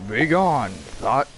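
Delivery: loud, high hammed-up command; mock banishing shout. Gamer banishing a foe, mock commanding shout. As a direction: cast it out with mock force.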